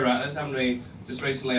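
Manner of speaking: ordinary delivery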